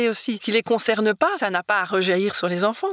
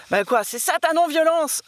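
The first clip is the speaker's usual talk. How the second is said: mock-indignant, higher retold question; indignant retort; 8 semitones higher than usual. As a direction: imitate a child's higher-pitched challenge. Retold indignant retort from daughter to father.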